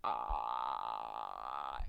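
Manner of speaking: very low, drawn-out mock bellow; playful deer bellow imitation. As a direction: groan low like a rutting stag. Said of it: "me when I see Adriana".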